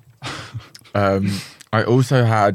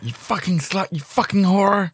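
higher-pitched mock growl of insults; mock dirty talk; 9 semitones higher than usual. Host mimics the Sex and the City guy's dirty talk. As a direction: toss out the insults in a hammed-up rant. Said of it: "where he's like".

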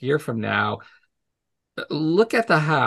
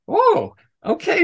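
high, slow "oh, okay"; mock dismayed surprise; 4 dB louder, slower than usual. Presenter seeing the computer crash, taken aback. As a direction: imitate being caught off guard, drawn out.

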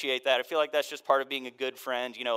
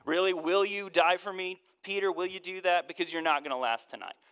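high, loud sarcastic put-down; mock him like a smug teacher; scoffing, condescending Jesus; 5 semitones higher, 4 dB louder than usual. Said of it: "we hear a little bit of condescension".

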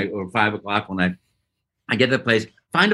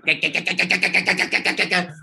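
higher, rapid-fire Space Invaders noise imitation; imitated game noise; 6 semitones higher than usual. Actor recalling Space Invaders closing in, rapid imitated pulses. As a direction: mimic a quickening arcade pulse with the mouth. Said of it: "And they're going".

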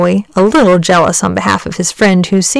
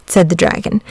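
usual speaking voice

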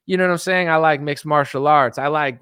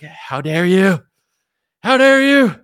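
mock outrage; 7 dB louder, slower than usual. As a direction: build from huffy to shrieking outrage. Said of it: "They're like".

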